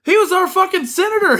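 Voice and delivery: high, laughing outburst; laughing disbelief. Real, not acted. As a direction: laugh it out in shock. Rubio loses home state.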